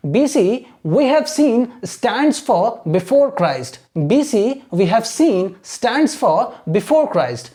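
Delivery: very slow, measured English in separated phrases; model reading. Shown as a demonstration. Teacher showing students how to read, model reading. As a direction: read out word groups for learners to copy. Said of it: "ऐसे पढ़ना है".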